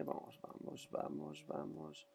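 slow urgent whisper. Real, not acted. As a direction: whisper it urging yourself on. Urging under breath.

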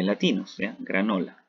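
ordinary talk

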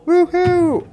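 high, loud whoop; gleeful whoop. Real, not acted. Player leaping off a ledge, gleeful whoop. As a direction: whoop as you leap.

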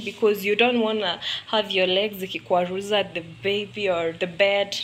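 ordinary talk, nothing marked

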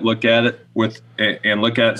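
ordinary delivery

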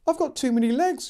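put-on high wailing girl, panicked. A narrator's reading. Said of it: "she wailed".

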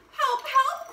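high, loud panicky call; court crying for help. A narrator's reading. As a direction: shout for help like a frantic courtier. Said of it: "cried the court".

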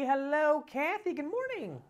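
high, sing-out cheery greeting. Real, not acted. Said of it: "I love your cheery voice".

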